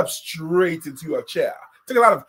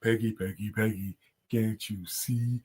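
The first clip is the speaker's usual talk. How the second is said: [name] voice; 8 semitones lower than usual. Retold or imitated. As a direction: rap it low like Biggie. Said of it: "in my [name] voice".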